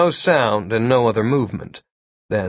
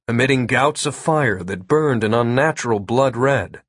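plain reading voice

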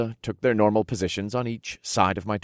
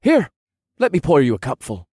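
perky, louder offer; cheerful; 6 semitones higher, 7 dB louder than usual. A narrator's reading. Lieutenant pouring the hero a cure, cheerful. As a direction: serve it up with chipper energy. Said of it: "in a cheerful voice".

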